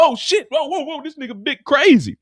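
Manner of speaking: high, loud panicked yelping; panicky yelp. Acted. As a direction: jump around yelping in fright. Tommy dodging bullets, panicking.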